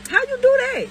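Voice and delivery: high, loud disbelieving squeal; incredulous squeal. Real, not acted. Incredulous at hypocrisy.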